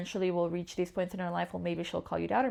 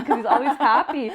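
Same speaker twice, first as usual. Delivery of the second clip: laughing, higher and louder; 6 semitones higher, 10 dB louder than usual. Real, not acted. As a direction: laugh it out fondly about him. Laughing about smiley partner.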